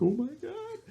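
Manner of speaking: high, rising frightened voice; mock dread. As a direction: let the pitch climb as danger closes in. Acting mounting fear in bed.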